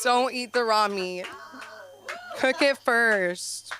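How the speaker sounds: slow, deliberate, every word weighted; slow emphatic motto. Shown as a demonstration. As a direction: lay it down like a life rule. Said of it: "Tattoo that".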